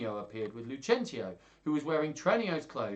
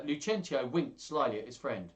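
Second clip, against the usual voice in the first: ordinary delivery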